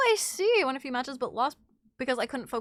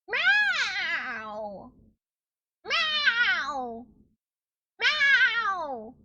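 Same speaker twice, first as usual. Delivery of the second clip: loud, high meows growing more insistent; cat meowing; 9 semitones higher, 8 dB louder, much slower than usual. Pretend. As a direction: yowl back like a demanding cat. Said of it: "like, mimic her".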